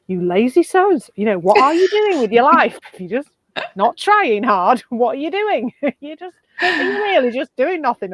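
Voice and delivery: loud, high scolding of a lazy person; loud mock scolding, laughing through it; scolding rant. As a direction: tell off a slacker with mock outrage. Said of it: "I'd be like".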